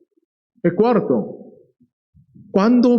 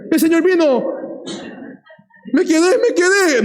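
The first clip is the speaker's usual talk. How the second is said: higher, hammed-up alarm; mock panic; 9 semitones higher than usual. Latecomer thinks the Lord came and left him behind, mock panic. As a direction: wail it like someone left behind.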